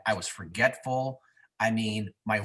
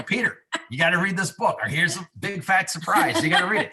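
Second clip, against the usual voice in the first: raised, emphatic mock lecture; pressing a friend to read the book, raised and insistent; insistent retelling; 6 semitones higher, 4 dB louder than usual. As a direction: push him to read the book, loud and pitched up. Retold insistence to Pete.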